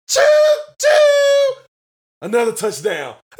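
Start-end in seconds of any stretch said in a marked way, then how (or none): 0.1-1.6 high whistled choo choo; train whistle. Pretend. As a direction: toot it like a steam whistle.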